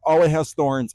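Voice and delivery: high, loud yelp of pain; startled cry. Acted. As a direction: shout it as the thorns jab. Pricked by thorns.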